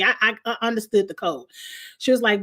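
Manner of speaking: usual speaking voice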